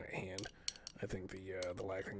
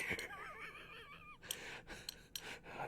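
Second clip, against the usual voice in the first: high creepy giggle; 6 dB quieter than usual. Acted.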